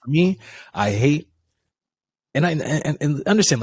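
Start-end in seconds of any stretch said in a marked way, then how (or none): 0.7-1.3 HATE stressed hard; emphasis on HATE. Real, not acted. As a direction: lean heavily on the word "hate". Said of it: "utmost emphasis on the word hate".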